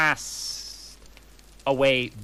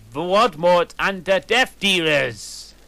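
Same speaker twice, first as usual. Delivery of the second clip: dramatic reveal; 6 semitones higher, 7 dB louder than usual. Acted.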